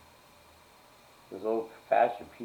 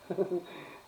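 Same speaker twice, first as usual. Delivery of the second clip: high giggle; 10 semitones higher, 4 dB quieter than usual. Real, not acted. Laughs at own joke.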